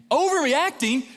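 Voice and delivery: high, loud, falling retort; outraged echo. Retold or imitated. Dad echoing his wife's question, outraged. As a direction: throw the word back, incensed.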